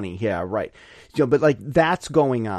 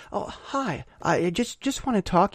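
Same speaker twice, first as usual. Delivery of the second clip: gentle cop; 4 semitones higher, 5 dB quieter than usual. Retold or imitated. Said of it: "They pull you".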